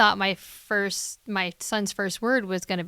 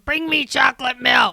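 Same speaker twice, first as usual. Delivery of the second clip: louder, bossy call across rooms; mock bellow; 4 semitones higher, 7 dB louder than usual. Mother imitating her son demanding milk, mock bellow. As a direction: boss mommy around from the couch. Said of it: "bellowing to the other room".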